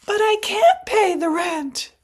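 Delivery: high, loud, pleading damsel; damsel in distress. Acted. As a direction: wail it like a helpless maiden. Melodrama heroine pleading.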